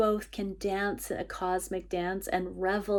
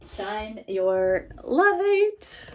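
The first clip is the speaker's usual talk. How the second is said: drawn-out slow card reading; 5 dB louder, much slower than usual. Real, not acted. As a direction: stretch each word out grandly. Card title read out slowly.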